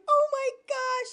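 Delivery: high, drawn-out mock alarm; freaking out. Doctor freaking out at the roach, mock panic. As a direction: imitate someone in a slow squeal of panic. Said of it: "The doctor's freaking out".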